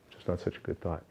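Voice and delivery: breathy near-whisper aside; hushed confession. Real, not acted. Guest admits an unworthy thought to the interviewer, whispered. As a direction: whisper it like a small shameful admission.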